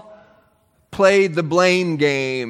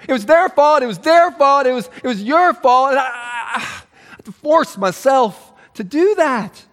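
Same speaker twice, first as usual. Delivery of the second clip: mock-indignant Saul shouting blame; blaming outburst; 6 semitones higher than usual. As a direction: cry out the excuse. Saul's blame, voiced loudly.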